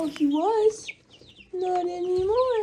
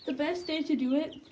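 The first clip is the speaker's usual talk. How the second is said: ordinary delivery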